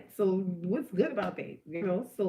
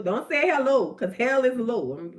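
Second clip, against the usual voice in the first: ordinary talk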